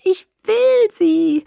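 very high, loud, drawn-out craving; high, clear moan. A narrator's reading. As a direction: wail it slowly with desperate hunger. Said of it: "stöhnte das Mädchen mit hoher, klarer Stimme".

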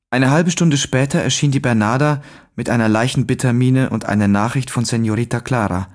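ordinary delivery

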